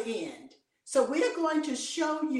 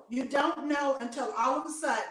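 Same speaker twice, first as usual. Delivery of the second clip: ordinary talk, nothing marked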